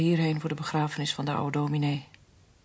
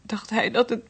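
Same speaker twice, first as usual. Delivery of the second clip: hoog en luider, de stem knapt; stem breekt in huilen; 7 semitones higher, 5 dB louder than usual. Acted. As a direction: laat de tranen doorbreken midden in de zin. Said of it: "Haal maar eens flink uit". (dochter barst in huilen uit om haar vader)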